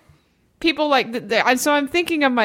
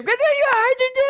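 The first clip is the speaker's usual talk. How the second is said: mock impatient yell; 13 semitones higher than usual. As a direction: imitate her husband yelling at her. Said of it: "you would stand there".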